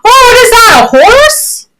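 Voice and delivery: delighted, sing-song high questions; playful amazement. Real, not acted. Tutor reacts to child's toy horse.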